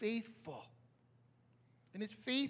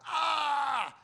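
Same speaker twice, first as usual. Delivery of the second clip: sharp loud ouch cry; pained yelp; 6 semitones higher, 6 dB louder than usual. Shown as a demonstration. Pastor mimics hitting a finger with a hammer, a sharp loud yelp. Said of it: "when you hit your finger with the hammer".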